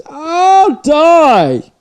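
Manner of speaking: sudden high yelp of exasperation; exasperated outburst. Real, not acted. Vlogger ranting about TV-obsessed people, loud yelp. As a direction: throw it up high and loud like it's killing you. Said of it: "one thing that kills me".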